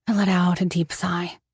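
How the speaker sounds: ordinary delivery, nothing marked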